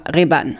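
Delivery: "Ray-Ban" as French ré-bane; prononciation française. Shown as a demonstration. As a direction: demonstrate the French "Ray-Ban", short pure é, full final -ane. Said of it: "En français, on dira". (« Ray-Ban » dit à la française, « ré-bane »)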